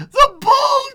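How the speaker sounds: straining, very high shriek; loud cry. Acted. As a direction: scream it while panting with effort. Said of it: "she cried loudly".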